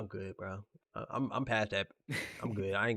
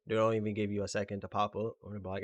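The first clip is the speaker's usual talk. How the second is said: ordinary talk, nothing marked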